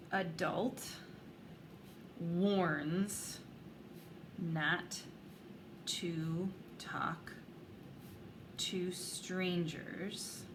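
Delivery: slow, word by word, while writing it down; slow dictation. Shown as a demonstration. Teacher writing it on the board, slow dictation. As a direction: spell it out slowly for the class. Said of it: "that's where I'm gonna write".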